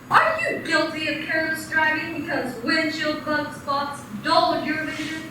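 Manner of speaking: normal talking voice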